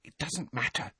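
put-on soft, high hushed girl's voice; quiet, discreet aside; whispered. A narrator's reading. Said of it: "Hermione whispered".

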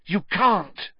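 high, urgent insistence; winded plea; breathless. A narrator's reading. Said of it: "he said breathlessly".

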